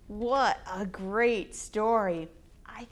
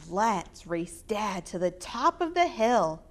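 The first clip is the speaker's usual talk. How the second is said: ordinary delivery, nothing marked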